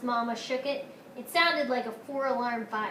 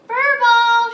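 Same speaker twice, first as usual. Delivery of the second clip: put-on high, very loud toddler shout; baby sister repeating the name, high and very loud; gleeful shout; 11 semitones higher, 18 dB louder than usual. A narrator's reading. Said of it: "shouted Honey, laughing".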